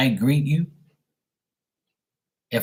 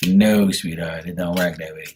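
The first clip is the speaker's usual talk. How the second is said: dismissive refusal; 7 semitones lower than usual. Acted. Turning down the imagined flatterer.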